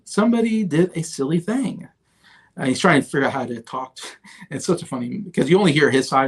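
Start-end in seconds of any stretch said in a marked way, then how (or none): 0.0-1.9 much higher, slow, sheepish mock president voice; hesitant, apologetic put-on voice breaking bad news; nervous president confessing a blunder on the phone. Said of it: "and be like".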